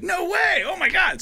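very high squealing disbelief; overjoyed, losing it; high-pitched thrilled yell; excited shout. Retold or imitated. Reacting to being a fan's hero.

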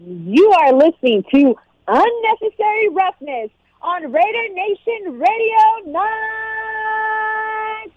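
slow, stretched wrestling ring announcer. Shown as a demonstration. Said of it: "in your ring announcer voice".

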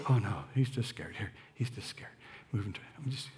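soft, low, whispered calming; soothing hush. Retold or imitated. Animal worker soothing a scared dog, soft and whispered.

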